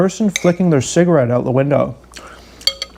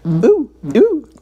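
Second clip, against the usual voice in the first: mock hoots; 9 semitones higher than usual. Mock excitement in his mind.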